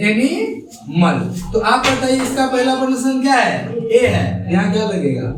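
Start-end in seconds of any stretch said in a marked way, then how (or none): 0.1-1.2 animal stretched out very slowly; slow model word. Shown as a demonstration. Teacher slowly modelling the word for students. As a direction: draw out animal syllable by syllable. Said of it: "ऐसे करके".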